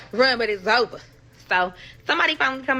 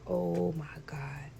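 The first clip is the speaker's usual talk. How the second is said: slow hushed whisper; 4 semitones lower, 9 dB quieter, slower than usual. Retold or imitated. Man reacting to news, hushed slow whisper.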